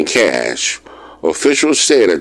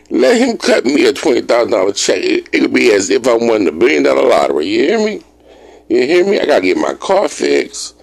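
excited; 7 semitones higher, faster than usual. Real, not acted. Dreaming aloud to viewers about spending a windfall, hyped. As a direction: get worked up over the money.

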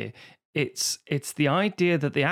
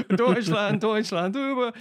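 high, louder mock German chant; hammed-up comic German, pitched up; American faking German; 6 semitones higher, 3 dB louder, slower than usual. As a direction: blurt out bad German desperately. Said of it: "there's an American saying".

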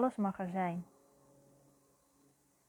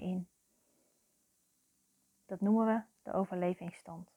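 guided meditation. Real, not acted. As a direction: guide the listener into their feelings, phrase by phrase. (langzame, begeleide meditatie met lange pauzes)

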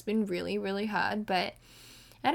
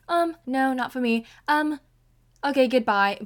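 raised, louder brush-off; dismissive brush-off; 4 semitones higher, 8 dB louder than usual. Retold or imitated. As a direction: wave him off, mock breezy. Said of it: "I was like".

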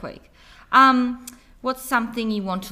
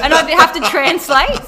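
laughing; 9 semitones higher, 14 dB louder than usual. Real, not acted. Laughing about French bulldog voice.

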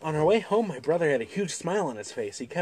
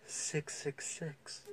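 gasp then whisper; 15 dB quieter, much slower than usual. Acted. Gasping horror over ticket number.

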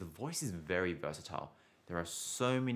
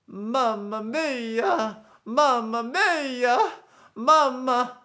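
very high, very slow, loud operatic Mamma mia; over-the-top cartoon Italian plumber wail; exaggerated Mario; 14 dB louder, much slower than usual. Shown as a demonstration. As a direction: ham it up like Mario, stretched way up high. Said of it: "very, very exaggerated".

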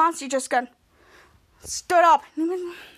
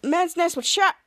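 usual speaking voice

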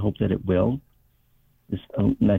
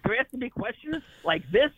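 high, worked-up retelling, much higher pitch; exasperated protest; 11 semitones higher than usual. Retold or imitated. Patient protesting to friend, high exasperated retort. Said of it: "I go".

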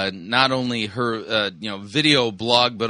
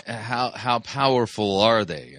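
ordinary talk

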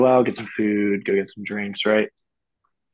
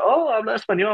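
high-pitched imitated surprise; pleasantly surprised; 12 semitones higher than usual. Gang member hearing him speak Spanish, surprised and high. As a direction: act out a delighted double take, pitched way up.

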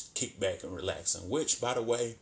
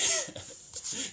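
very high, airy killer-doll cackle; mock evil laugh. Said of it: "he's up there like".

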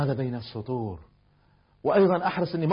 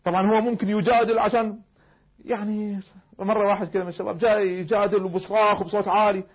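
ordinary talk

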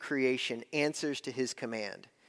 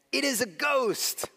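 higher-pitched cry of alarm; acted frightened outcry; fearful cry; 9 semitones higher than usual. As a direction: cry out as terrified disciples. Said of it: "they cried out in fear".

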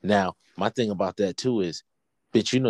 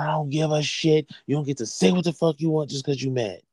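higher-pitched heated telling-off; retold fatherly scolding; 8 semitones higher than usual. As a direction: lay down the law, voice raised in pitch. Retold father scolding his child.

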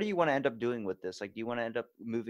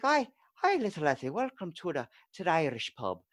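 high-pitched leprechaun Irish lilt; hammed-up Irish welcome, starting very high; Irish accent; 7 semitones higher, 6 dB louder than usual. Shown as a demonstration. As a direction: greet like a loud Irish leprechaun. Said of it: "higher pitch".

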